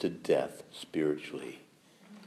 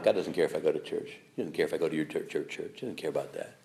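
lower, softer dismissive voice; a hint of a bored skeptic; mocking a doubter; 7 semitones lower, 6 dB quieter than usual. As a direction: mutter it like a shrugging skeptic. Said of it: "I hear that all the time".

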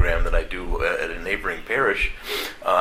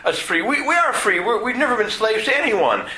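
much higher, louder protesting voice of the crowd; indignant crowd; 3 dB louder than usual. Retold or imitated. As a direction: squeal out the objectors' outrage. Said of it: "and they say".

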